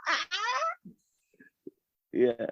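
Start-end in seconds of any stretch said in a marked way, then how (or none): 0.1-0.9 tiny, very high mock scream; high-pitched, quiet retold shriek; retold small scream. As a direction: squeal softly in pretend fright. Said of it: "and I was like".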